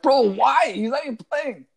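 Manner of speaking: loud, high, disbelieving protest; incredulous outburst. Retold or imitated. Acted own incredulous reaction to news.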